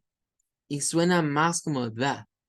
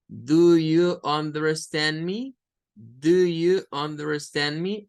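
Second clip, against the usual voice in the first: careful slow English; 3 dB louder, slower than usual. Shown as a demonstration. Teacher contrasting careful speech to students. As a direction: Do you understand me every syllable full and slow. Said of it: "pasamos de decir".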